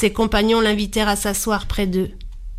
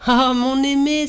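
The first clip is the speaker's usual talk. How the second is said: cry out; 4 semitones higher than usual. A narrator's reading. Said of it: "s'écria Karachash".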